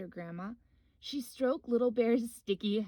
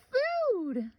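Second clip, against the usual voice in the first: much higher, louder cry; excited; 12 semitones higher, 7 dB louder than usual. Acted. Little Bear spotting bees' nest, excited high cry. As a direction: shout out the find with glee. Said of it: "he told Grandma".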